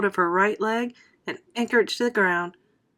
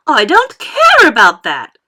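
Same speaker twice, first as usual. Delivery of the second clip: slow, loud, higher bratty yell; shout; 4 semitones higher, 13 dB louder than usual. A narrator's reading. Veruca shouts at her mother, slow and bratty. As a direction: shout it back in a tantrum. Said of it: "shouted Veruca".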